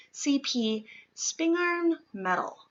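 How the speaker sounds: ordinary delivery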